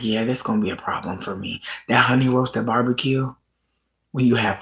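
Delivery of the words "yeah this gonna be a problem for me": soft hooked admission. Retold or imitated. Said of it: "You're like".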